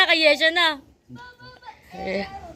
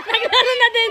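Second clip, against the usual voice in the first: normal talking voice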